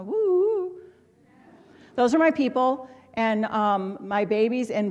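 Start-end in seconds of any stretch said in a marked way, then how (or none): 0.0-0.7 high mock ghostly woo, hammed-up; spooky woo-woo. Laughing at her own intuition with a high mock spooky woo. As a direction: tease her own sixth sense with a mock spooky wail.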